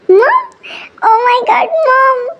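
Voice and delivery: high, excited cry of mom; delighted surprise. Acted. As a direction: greet her in happy shock. Son surprised by mother's arrival.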